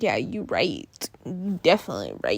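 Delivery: low, lazy mock agreement; deep deadpan you right; mock agreeing. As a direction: drop the voice low and shrug it off. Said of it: "I'm like".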